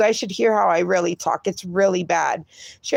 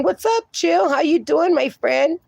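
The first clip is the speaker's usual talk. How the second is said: high-pitched, cheery hello to a friend; upbeat greeting; 6 semitones higher than usual. Real, not acted. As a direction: greet a newcomer with a lifted voice. Greeting a chat friend who joined.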